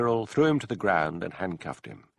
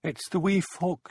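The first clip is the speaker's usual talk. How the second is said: frightened; 10 semitones higher, slower than usual. A narrator's reading. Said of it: "said through dry lips".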